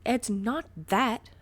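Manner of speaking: slow, loud insistent denial; arguing. A narrator's reading. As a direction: insist firmly. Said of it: "argued Ray".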